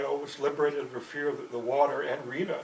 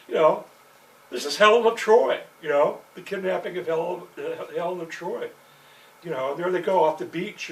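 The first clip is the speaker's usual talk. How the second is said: ordinary delivery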